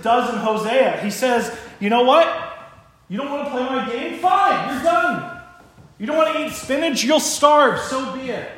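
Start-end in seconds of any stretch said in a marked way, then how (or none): 1.8-8.5 high, loud acted ultimatum; fed-up God. God to Hosea's people like a parent, angry ultimatum. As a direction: give up on them in a raised, angry voice. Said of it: "that's what God does".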